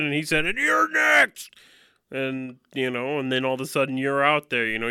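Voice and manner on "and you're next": high, drawn-out cartoon menace; hammed-up maniac announcing his next victim; mock killer threat. Neighbor who just slit a throat, hammed-up threat.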